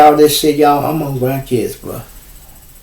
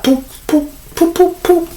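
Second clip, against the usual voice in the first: mock noise. Imitating people shushing.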